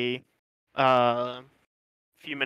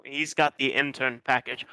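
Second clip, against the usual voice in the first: ordinary talk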